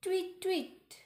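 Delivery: high chirpy tweet; bird call. Acted. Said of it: "called Molly loudly".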